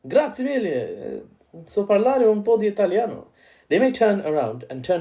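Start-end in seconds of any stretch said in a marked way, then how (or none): 0.1-3.3 much higher, laughing, hammed-up European voice; a defiant Europe, mock Italian flourish at the end. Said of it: "But the EU might turn around and say".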